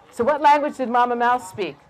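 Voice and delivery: ordinary delivery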